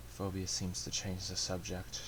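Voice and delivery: soft, subdued first-person narration; hushed, even storytelling winding down toward sleep; story narration. A narrator's reading. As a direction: read it as a quiet inner monologue.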